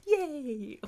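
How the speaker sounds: high, drawn-out little yay; mock cheer. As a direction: cheer playfully for the happy ending. Mock cheer at happy end.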